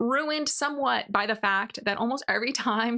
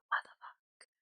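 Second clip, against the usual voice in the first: stunned whisper; 12 dB quieter than usual. Retold or imitated. Sylvia's stunned reaction.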